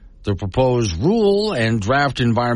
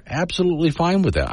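high, mock-cheerful agreement; sarcastic; 9 semitones higher, faster than usual. Shown as a demonstration. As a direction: toss it off with a sarcastic lift. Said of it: "I'm not saying that sarcastically. I am".

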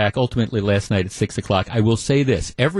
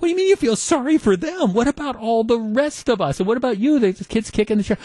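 much higher, exasperated retort; incredulous outburst; 10 semitones higher than usual. Retold or imitated. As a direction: fire back at your wife in disbelief. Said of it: "And I'm like".

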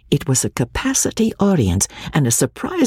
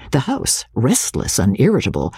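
usual speaking voice

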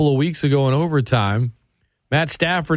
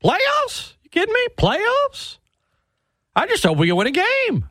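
squeaky, much higher mock Jim Mora rant. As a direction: imitate the coach's famous playoffs rant in a shrill falsetto. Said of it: "They just remember the".

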